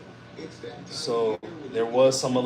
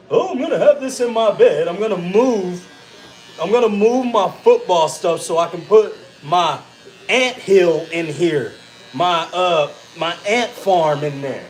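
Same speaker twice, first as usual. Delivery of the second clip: louder, higher mock kid voice; A J imitation; 5 semitones higher, 5 dB louder than usual. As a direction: imitate your son busily making plans. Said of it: "What does A J do?".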